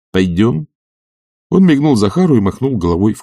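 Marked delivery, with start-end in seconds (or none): 0.1-0.7 casual rising invitation; coachman's questioning tone; inviting coachman. A narrator's reading. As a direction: ask him along lightly. Coachman Artemy invites Zakhar.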